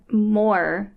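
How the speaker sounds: normal talking voice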